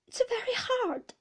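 put-on high, pained young wife's protest; complaining wife. A narrator's reading. Mother complaining to her husband about the house, high and aggrieved.

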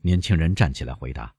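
plain reading voice

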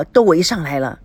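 plain reading voice

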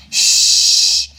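loud, long shushing; sharp storybook "shh"; urgent hush. A narrator's reading. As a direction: hush them forcefully. Hiding inchworm hushes Toad.

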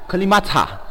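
克里马擦 said high, like Japanese; 克里马擦 with a Japanese-style lilt; Japanese-sounding. Retold or imitated. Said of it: "你说的有一种日文的感觉".

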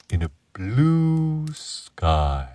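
ordinary delivery

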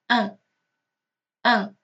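UN as a nasal vowel, no N sounded; silent final n. Shown as a demonstration. Teacher gives the first word alone, final n silent. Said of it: "这个呢是不发音的".

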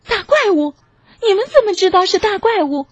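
high, quick startled questions; frightened duck, rushing the words. A narrator's reading. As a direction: ask in alarm, pitch jumping up. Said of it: "鸭子吓了一跳".

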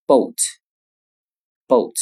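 boat in an American accent, back vowel gliding to u; teacher reading boat in American English. Shown as a demonstration. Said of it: "而美音读成".

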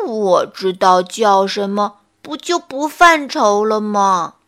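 low, resigned bear voice; a bear who can do nothing about it; helpless sigh. A narrator's reading. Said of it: "笨熊无奈地说".

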